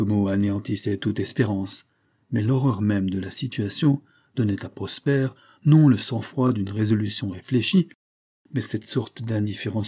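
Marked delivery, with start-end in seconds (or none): none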